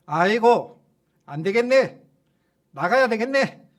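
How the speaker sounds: a loud, grudging cry of giving up; demon's yell. Retold or imitated. Demon giving in to the pastor, loud grudging cry. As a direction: bellow it like a beaten demon. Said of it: "하고 소리를 치더라는 거예요".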